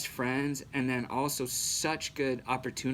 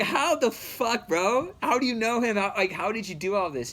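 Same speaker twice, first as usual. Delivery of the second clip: stunned disbelief; 9 semitones higher, 7 dB louder than usual. Retold or imitated. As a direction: blurt it out in total disbelief, higher and louder. Astonished at friend's hookup.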